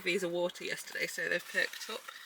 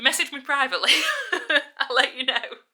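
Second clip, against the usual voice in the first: laughing; 6 semitones higher, 8 dB louder than usual. Real, not acted. Laughing teasing offer.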